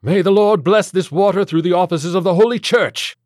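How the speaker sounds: high, full-force priestly bellow; loud, urgent liturgical shout; bellowed blessing. A narrator's reading. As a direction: bellow the prayer over the chaos. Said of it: "he bellowed".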